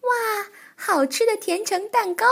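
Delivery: high, thrilled squeal of delight; excited gasp. Acted. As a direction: wow at the sight of cake. Child character delights at cake.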